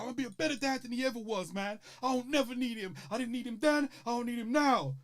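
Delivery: imitating Will holding back tears; hurt defiance. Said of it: "he's like".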